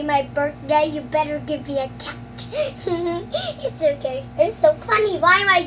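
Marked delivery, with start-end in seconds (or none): 2.2-3.6 breathless giggling fit; real laughter. Real, not acted. Laughing at her own birthday joke to viewers, breathless giggle. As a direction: crack up helplessly.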